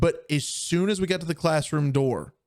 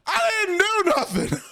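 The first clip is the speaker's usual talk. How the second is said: put-on much higher, indignant child voice, laughing through it; kid's squeaky protest. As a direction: squeak it out like a wronged second grader. Second grader protesting to the principal.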